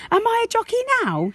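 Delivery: put-on high, fast, thrilled child's question; bursting with pride on her new horse; excited little girl. Josie rides into parents' room, high excited child.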